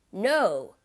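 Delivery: a loud seething no. A narrator's reading. The Count, wild with rage, seethes at Danglars. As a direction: spit it out in rage. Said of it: "he seethed".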